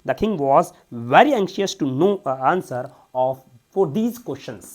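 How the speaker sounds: loud, slow reading of the story passage. Shown as a demonstration. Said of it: "reads the passage aloud loudly".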